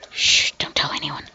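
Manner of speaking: hushed, low stage whisper; secretive whisper. Pretend. Gamer admits to being a little crazy, whispering. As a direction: shush them, then whisper the secret.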